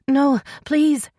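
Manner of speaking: high, loud panicked plea; panicked female character pleading; begging. A narrator's reading. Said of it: "she begged".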